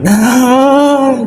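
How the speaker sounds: cracking up at own joke. Real, not acted.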